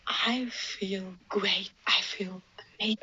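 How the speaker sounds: hushed, quiet whispered bliss; soft whisper. Retold or imitated. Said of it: "you're like".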